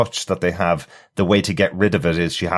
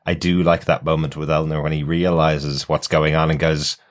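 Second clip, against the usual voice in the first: ordinary talk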